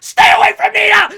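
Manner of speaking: very high, very loud acted shriek; jealous scream. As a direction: scream a rival away in mock rage. Macy warns off Noelle.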